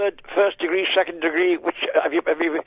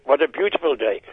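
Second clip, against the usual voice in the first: ordinary delivery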